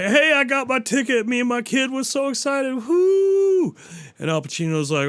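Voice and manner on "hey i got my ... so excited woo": high, eager mock cheer ending in a whoop; hammed-up excited guy, whooping; excited dad. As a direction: gush like a thrilled dad with his kid. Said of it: "he's like".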